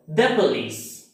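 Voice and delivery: short thuh in "the police"; "the" ends in a short vowel, not ee; weak the before consonant. Shown as a demonstration. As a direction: say "the police" with a quick, unstressed "the". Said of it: "this word is pronounced".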